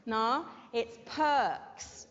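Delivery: perks said slowly, with the r left out; drawn-out perks with a silent r; perks, very slow, no r sound; slow model word. Shown as a demonstration. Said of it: "It's a silent R".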